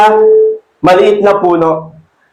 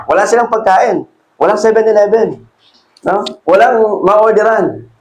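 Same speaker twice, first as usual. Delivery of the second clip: ordinary talk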